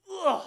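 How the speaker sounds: high, loud squeal of overwhelmed delight; grandpa's overjoyed squeal. Retold or imitated. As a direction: let out a burst of adoring squeal. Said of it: "I'm like".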